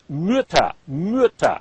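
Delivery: "Mütter" with short i through pursed lips; short rounded Ü. Shown as a demonstration. Teacher modelling the word, short rounded ü. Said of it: "you purse your lips".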